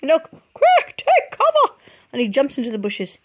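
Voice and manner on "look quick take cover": very high, loud shriek with a laugh; hammed-up squawk of alarm; turkey yells again, hammed up; panicked turkey. A narrator's reading. Turkey warning repeated.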